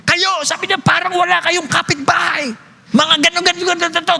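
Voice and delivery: mock the cursing neighbor; mimicking an angry neighbor. (ginagaya ang galit na kapitbahay)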